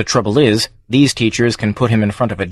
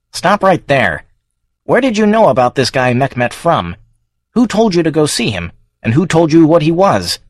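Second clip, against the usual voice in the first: higher-pitched, insistent questioning; sharp interrogation; 6 semitones higher than usual. Acted. Congressman cuts off the witness, sharp interrogation. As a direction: press the witness with rising demands.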